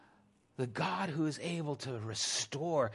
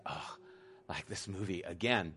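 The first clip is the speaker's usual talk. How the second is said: bored groan; 7 semitones lower, 7 dB quieter than usual. Retold or imitated. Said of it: "he's just like".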